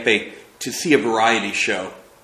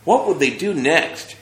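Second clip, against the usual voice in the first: Trina voiced a touch higher, wide-eyed wonder; amazed; 7 semitones higher, 3 dB louder than usual. Trina marvelling at the film beside Mac, amazed. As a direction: marvel at the moving pictures. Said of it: "observed Trina in amazement".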